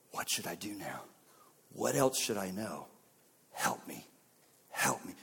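low, soft asking; humble plea. Acted. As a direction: ask for help quietly, voice dropped. Humble plea for help modelled.

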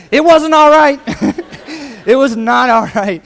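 loud laughing declaration. Real, not acted. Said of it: "laughing emphatic punchline".

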